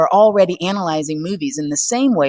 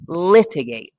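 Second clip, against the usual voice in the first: LIT-igate, leaning on the opening syllable; "litigate" with its first syllable stressed; slower than usual. Shown as a demonstration. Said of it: "The stressed syllable in the word litigate is the first".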